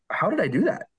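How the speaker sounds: high-pitched baffled self-quote; mock dismay at your own slip; retold disbelief. Retold shock at own accent slip.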